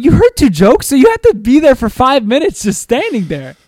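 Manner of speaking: high, loud incredulous outrage; retold anger. Boyfriend scolding girlfriend, loud and furious. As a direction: hit her with it, outraged. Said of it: "I was, like, so pissed".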